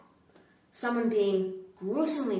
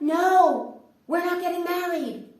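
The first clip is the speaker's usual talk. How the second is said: high and loud; exasperated refusal; 7 semitones higher, 9 dB louder, slower than usual. Retold or imitated. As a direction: shout the no back, fed up. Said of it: "And I'm like".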